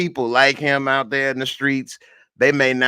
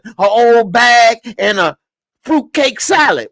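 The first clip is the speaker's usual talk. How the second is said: much higher, louder hammed-up threat; character impression; 9 semitones higher, 6 dB louder than usual. Darnell threatening the family with a gun, high mock-menacing impression. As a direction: brag and threaten, big and high. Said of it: "That's my impression".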